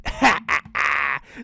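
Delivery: acted gloating ha-ha-ha; villain's laugh. A narrator's reading. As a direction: laugh like a smug predator. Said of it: "The dingo just laughed".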